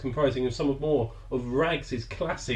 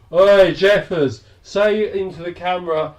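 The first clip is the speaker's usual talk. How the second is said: quavering treble; 5 semitones higher, 7 dB louder than usual. Retold or imitated. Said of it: "in a quavering treble".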